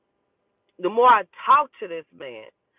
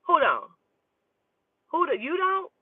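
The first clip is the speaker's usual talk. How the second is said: mock disbelief; 8 semitones higher than usual. Real, not acted. Teasing about oral sex.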